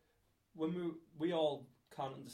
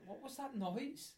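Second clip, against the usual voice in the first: slow and much higher puzzled query; mock puzzled; 10 semitones higher, slower than usual. Asking where the noise came from, slow and puzzled, higher. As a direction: ask it slowly, half asleep and baffled. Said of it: "And I'm like".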